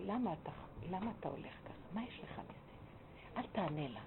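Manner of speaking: very soft, gentle coaxing; soft speech. Retold or imitated. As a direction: soothe him in a hushed, tender voice. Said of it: "נתן לי דיבור רך לאיש הזה". (דיבור רך ומרגיע, בשקט)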